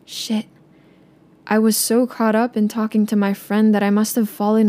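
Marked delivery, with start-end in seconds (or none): 0.1-0.5 quiet curse. Retold or imitated. Caught, realizing the mistake.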